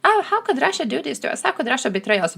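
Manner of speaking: high, mock-wounded whine. Mocking Israel's complaint about Russia, high mock whine. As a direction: whine it like a betrayed child. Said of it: "Bro, Russia doesn't give".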